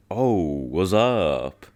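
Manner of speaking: slow, stretched mock ad-lib; lazy, slow hype ad-lib; drawn-out rapper ad-lib. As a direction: draw it out like the rapper on the record. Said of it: "I'll do my best to match his cadence".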